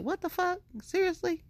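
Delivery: very high, incredulous retort at normal volume; acted disbelief. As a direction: replay your own baffled reaction. Own past disbelief acted out.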